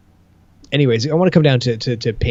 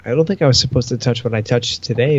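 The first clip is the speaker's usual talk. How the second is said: usual speaking voice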